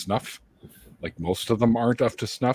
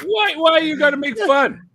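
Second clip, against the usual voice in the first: high, loud mock hurt; mock whiny protest; 10 dB louder than usual. Real, not acted. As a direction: whine that they're teasing. Protesting being teased.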